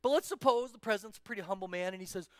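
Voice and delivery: ordinary delivery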